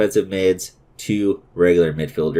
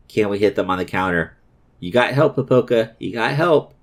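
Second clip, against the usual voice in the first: usual speaking voice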